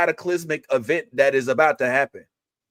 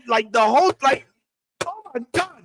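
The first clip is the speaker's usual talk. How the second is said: ordinary delivery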